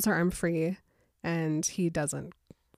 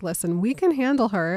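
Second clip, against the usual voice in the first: forceful Jacob; 2 semitones higher, 8 dB louder than usual. Retold or imitated. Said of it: "Jacob's like".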